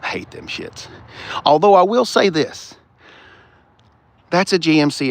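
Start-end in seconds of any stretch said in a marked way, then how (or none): 0.0-0.9 under-the-breath put-down. Real, not acted. Dismissive aside to a car.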